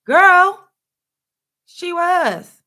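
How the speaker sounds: high, loud exasperated burst. Real, not acted. Vlogger snapping back at critics, high exasperated burst. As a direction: throw it back, incredulous. Exasperated retort about Whitney.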